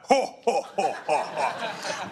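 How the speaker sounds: a hammed-up villain's chuckle; Jabba the Hutt laugh. Pastor mimics Jabba the Hutt laughing from heaven, mock belly laugh. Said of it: "like Jabba the Hutt".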